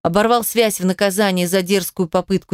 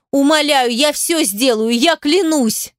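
desperate begging; 6 semitones higher than usual. A narrator's reading. Begging kidnapper.

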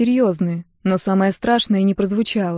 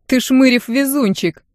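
slow, higher teasing drawl; amused smirk; 5 semitones higher, 4 dB louder than usual. A narrator's reading. Brunet teases acquitted comrade, smirking. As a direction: rib him with a smirk, drawing it out. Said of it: "усмехнулся и брюнет".

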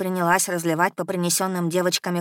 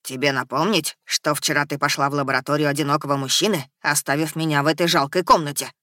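gurgling tentacle alien; 4 semitones lower than usual. Acted. Eddie the creature scolds.

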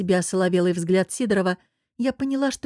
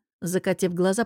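ordinary delivery, nothing marked

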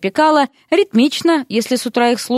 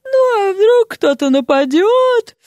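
lazy yawning voice; 12 semitones higher, 4 dB louder, slower than usual. A narrator's reading. Эрик зевает, лениво.

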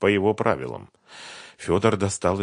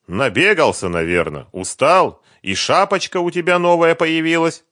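loud, high-pitched taunting satisfaction; smug, pleased; 10 semitones higher, 8 dB louder than usual. A narrator's reading. Фёдор teasing the tired man about his looks, smug. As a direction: crow over him, pleased. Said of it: "довольным голосом".

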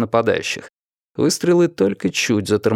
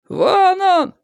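very high, combative cry; warlike yell. A narrator's reading. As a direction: yell it high to rally the others. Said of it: "завопил кто-то".

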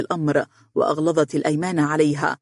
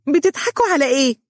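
much higher, fast huffy demand; a cross little girl, hands on hips; indignant girl; 10 semitones higher, 3 dB louder, faster than usual. A narrator's reading. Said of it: "وتقول بحنق". (بحنق طفولي)

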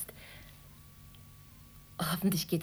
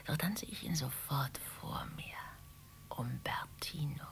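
wistful hush; 9 dB quieter than usual. Acted. Recalling her stern ex-husband.

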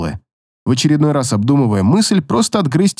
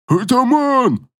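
high slow wailing call; monster wail; 8 semitones higher, much slower than usual. Acted. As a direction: wail for the chief. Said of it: "возопила эта хрень".

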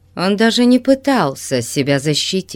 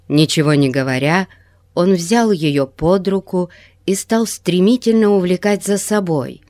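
ordinary delivery, nothing marked

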